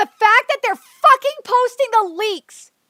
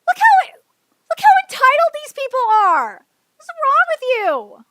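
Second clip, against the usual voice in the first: much higher, louder disbelieving shout; exasperated yelling; 6 semitones higher, 3 dB louder than usual. Real, not acted. Streamer shouts at fans defending theater recording, exasperated. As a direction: yell it in disbelief.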